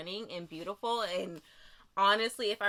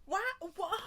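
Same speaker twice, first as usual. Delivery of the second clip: ordinary delivery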